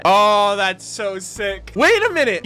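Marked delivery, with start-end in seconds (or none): 1.8-2.4 higher, sudden wait-what; sudden realisation. Real, not acted. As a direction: catch yourself as an idea hits. Sudden realisation watching cutscene.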